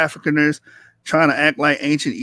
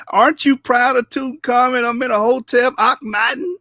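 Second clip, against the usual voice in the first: higher, laughing taunt at a rival viewer; mocking taunt; 6 semitones higher than usual. Real, not acted. Streamer taunting a rival viewer, mocking and laughing. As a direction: needle him, half laughing.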